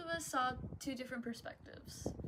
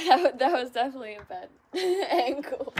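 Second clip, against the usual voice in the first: laughing; 14 dB louder, faster than usual. Real, not acted. Laughs at camera angle.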